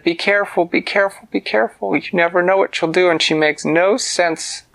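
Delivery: high, urgent warning with a touch of worry; anxious warning. Acted. Characters anxiously warn Winona.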